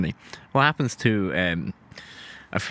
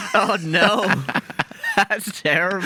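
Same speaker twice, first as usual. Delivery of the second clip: laughing; 11 semitones higher, slower than usual. Real, not acted. Reacting to wife joke.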